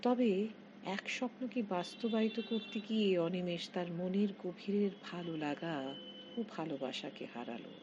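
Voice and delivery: usual speaking voice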